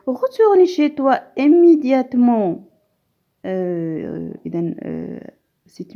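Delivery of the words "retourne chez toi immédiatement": loud, firm command; commanding order. Shown as a demonstration. Teacher to class, demonstrating an imperative loudly. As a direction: bark Retourne chez toi immédiatement out as an order. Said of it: "c'est un ordre".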